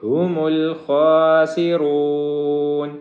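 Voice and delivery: الْخَاسِرُونَ with a heavy خ and ر; slow, heavy letters; heavy خ and ر held long; heavy kha. Shown as a demonstration. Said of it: "خا کو موٹا پڑھیں گے".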